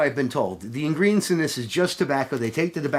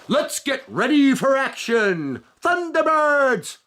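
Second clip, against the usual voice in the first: higher, slow, drawn-out announcer call; TV show announcer; 8 semitones higher, 4 dB louder, slower than usual. Retold or imitated. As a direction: belt it out like an old kids' show intro. Quoting the Thunderbirds TV show intro.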